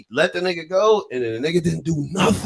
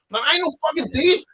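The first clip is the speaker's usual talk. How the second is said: ordinary talk, nothing marked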